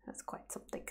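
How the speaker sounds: under-the-breath whisper. Real, not acted. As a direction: whisper it to yourself. Whispered aside.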